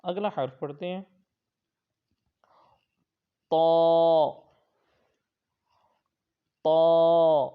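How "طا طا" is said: طا thick and loud, full-mouthed; a heavy recited طا; heavy taa. Shown as a demonstration. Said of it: "یہ بلو حرف ہے، یعنی حروف مستعلیہ". (طا ہمیشہ موٹا)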